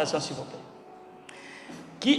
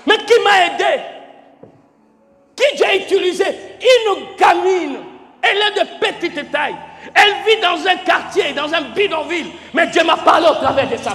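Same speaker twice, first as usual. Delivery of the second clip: raised, emphatic preaching with pauses; fervent preaching; 5 semitones higher than usual. Acted. Pastor testifying to his congregation, fervent preaching. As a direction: preach it out to the hall.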